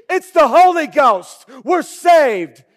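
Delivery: loud, high, glad cry; joyful relief. Retold or imitated. Disciples greeting Jesus on the water, loud and overjoyed. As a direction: shout it like rescue arrived. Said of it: "Instead of the disciples saying".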